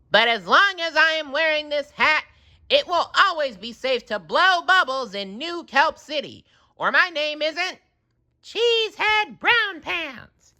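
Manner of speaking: high proud speech; solemn promise to the city; SpongeBob voice. A narrator's reading.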